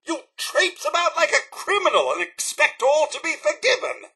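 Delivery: high-pitched, affronted telling-off; indignant scolding. Acted. Butler scolding a wayward companion, high and indignant. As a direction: scold like a scandalised servant.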